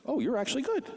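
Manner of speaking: high, surprised; surprised praise. Retold or imitated. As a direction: sound startled he's good.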